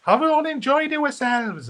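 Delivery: high Rhondda Welsh singer's voice, called to the crowd; Rhondda accent. Retold or imitated. H asking the crowd, Rhondda accent. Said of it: "I can't really do the Rhondda accent".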